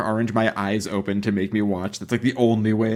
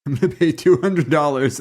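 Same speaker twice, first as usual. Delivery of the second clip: louder mock boast, laughing through it; 4 semitones higher, 5 dB louder than usual. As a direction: laugh as you brag.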